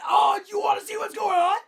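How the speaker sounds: high, indignant re-enacted shout; screaming. Ranter snapping at coworker who came out, screaming. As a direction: throw the question back in a rage. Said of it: "I'm screaming it".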